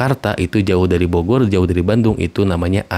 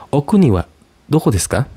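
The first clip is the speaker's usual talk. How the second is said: bright asking voice; 7 semitones higher than usual. Shown as a demonstration. Teacher asking a foreigner their country, higher voice. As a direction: ask a visitor brightly where they are from.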